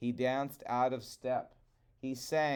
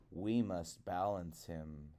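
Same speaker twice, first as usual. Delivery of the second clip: low, soft crow voice; low calm crow; Old Man Crow; 5 semitones lower, 6 dB quieter than usual. Acted. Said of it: "said Old Man Crow".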